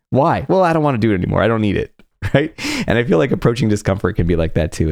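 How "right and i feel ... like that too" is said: ordinary delivery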